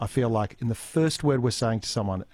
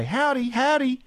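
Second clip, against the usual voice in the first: bright, high sing-song howdy; cheery greeting; 14 semitones higher, 4 dB louder than usual. Retold or imitated. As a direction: greet the dog with a chirpy howdy. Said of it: "of course I say".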